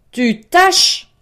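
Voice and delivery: loud, high-pitched tyrant's shout; cri féroce. A narrator's reading. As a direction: explode in outraged disbelief. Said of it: "cria le tyran férocement". (tyran qui crie férocement, voix haute)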